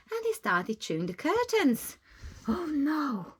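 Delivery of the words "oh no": usual speaking voice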